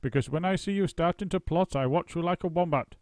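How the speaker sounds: put-on old wife's shrill-pitched threat; Missus Twit warning. A narrator's reading. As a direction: wag a finger at him with your voice. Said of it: "Missus Twit said".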